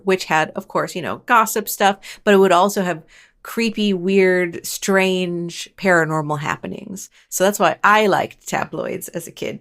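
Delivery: ordinary talk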